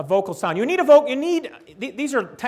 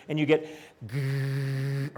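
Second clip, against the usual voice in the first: a long low buzzing grrr; growl demo; 4 semitones lower, 4 dB quieter, much slower than usual. Shown as a demonstration. Teacher demonstrating a long voiced growl. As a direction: hum it out like a fridge. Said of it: "the electric hum of the refrigerator".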